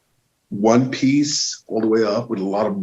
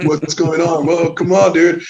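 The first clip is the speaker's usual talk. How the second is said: confused excited outcry; 7 semitones higher, 5 dB louder than usual. Retold or imitated. Cops storm in, crowd confused.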